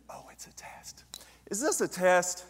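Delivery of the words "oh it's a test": hushed aside to himself; whispering. Acted. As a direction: whisper it like a secret realization. Aside after the voice answers.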